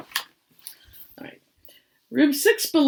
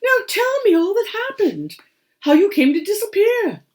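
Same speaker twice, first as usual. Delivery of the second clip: high, louder eager old badger; lightly voiced grandpa; 12 semitones higher, 6 dB louder than usual. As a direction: grandpa eagerly asking the rabbit. Grandpa asking the rabbit.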